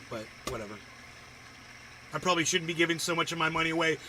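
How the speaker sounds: ordinary talk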